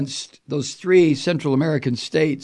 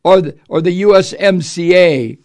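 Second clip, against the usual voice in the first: louder pompous read; mock Trump quote; 4 semitones higher, 8 dB louder than usual. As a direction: declaim it like a self-important president. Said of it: "he added this".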